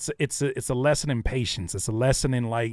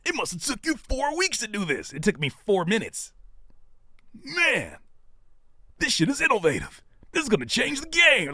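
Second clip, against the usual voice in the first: higher, excited put-on praise; higher mock hype praise; mock hype fan; 9 semitones higher than usual. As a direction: oversell it. Mimicking overhyped fans.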